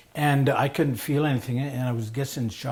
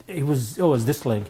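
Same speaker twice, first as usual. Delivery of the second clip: normal talking voice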